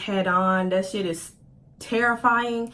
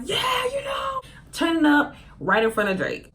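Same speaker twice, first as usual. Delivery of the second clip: high-pitched hyped whoop; celebrating; 10 semitones higher than usual. Acted. Celebrating a top score in front of [name], excited mock cheering. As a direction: crow it high, like turning up at a concert.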